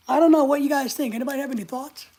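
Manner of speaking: ordinary delivery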